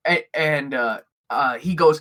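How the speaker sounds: ordinary talk, nothing marked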